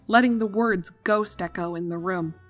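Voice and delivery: usual speaking voice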